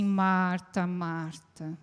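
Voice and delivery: calling her name softly, trailing off; fading gentle call. Shown as a demonstration. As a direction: let the second Marta fade to near silence. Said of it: "Eu dou essa entonação".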